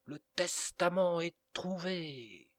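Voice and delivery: high loud shout, slow, stretching out testament; as loud as he can. A narrator's reading. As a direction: call it out at the top of his voice. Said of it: "d'une voix aussi élevée qu'il put".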